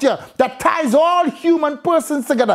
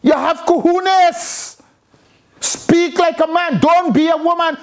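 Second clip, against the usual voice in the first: higher, emphatic scolding; indignant rebuke; 5 semitones higher than usual. Real, not acted. Preacher tells men to speak like men, indignant rebuke. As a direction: take them to task with forceful exasperation.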